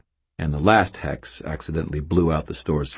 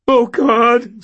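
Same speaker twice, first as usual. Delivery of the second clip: moaning; 8 dB louder than usual. A narrator's reading. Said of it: "Stan moaned".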